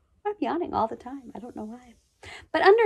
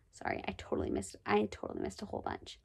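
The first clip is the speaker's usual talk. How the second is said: whispered apology to herself; quiet aside; 2 semitones lower, 14 dB quieter, faster than usual. Real, not acted. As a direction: mutter an embarrassed sorry. Reader apologizes, lost place.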